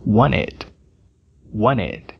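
wanted dito "waned"; wanted sem T. Shown as a demonstration. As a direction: tire o T de wanted. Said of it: "a letra T não é pronunciada". (wanted soa como "waned")

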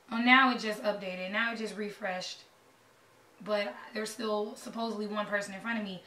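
ordinary talk

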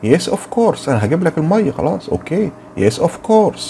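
lightly voiced cheerful reply; playful answering voice. Shown as a demonstration. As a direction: answer like a helpful friend. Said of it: "لما هرد هقول إيه؟".

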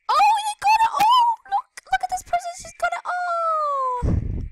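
high, loud amazed shout; excited shriek. Real, not acted. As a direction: shriek in disbelief. Sees player with all TNT.

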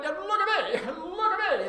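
high, loud mimicry broken by a laugh; jeering high voice with laughter; mocking imitation. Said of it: "That's what you sound like".

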